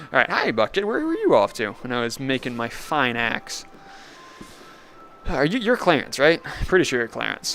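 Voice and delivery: normal talking voice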